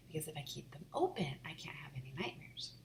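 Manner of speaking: hushed whisper to the stuffed animals. Acted. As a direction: whisper so the dark can't hear. Child whispering to toys.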